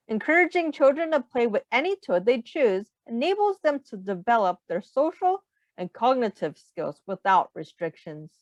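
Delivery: ordinary delivery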